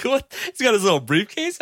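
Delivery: high-pitched, baffled "what?"; incredulous. Retold or imitated. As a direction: react in mock disbelief, voice jumping up. Mock disbelief at film scene.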